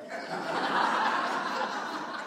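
short airy laugh at the punchline; breathy laugh. Real, not acted. Preacher laughs at his own punchline, airy. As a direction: let a laugh burst out.

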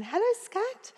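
high, small imitated hello; little voice. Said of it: "this little voice behind me".

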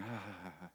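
soft, breathy imitation of a panting dog; imitated dog pant, all breath. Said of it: "looks up at me panting".

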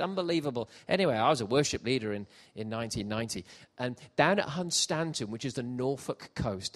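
ordinary delivery

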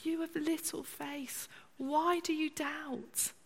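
soft, slow, reassuring question; quietly ask, not scolding; tender correction; gentle Jesus voice. Acted. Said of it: "He's just saying".

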